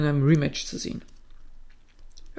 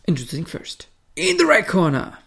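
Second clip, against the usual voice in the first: boxing ring announcer. Retold or imitated. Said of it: "stellt er natürlich noch die ganzen Leute in den Ecken vor".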